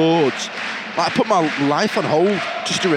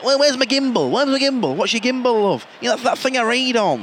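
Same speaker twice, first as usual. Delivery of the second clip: higher-pitched, querulous grandparent hunting for a gadget; confused grandparent; 6 semitones higher than usual. Pretend. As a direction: imitate a baffled old relative mangling a tech name. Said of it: "Your grandparents try to".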